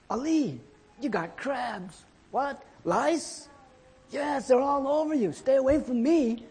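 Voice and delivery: high-pitched, hammed-up panic; mock alarm. Friend discovering lice on Ali, high mock alarm. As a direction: recoil in comic horror, pitch way up.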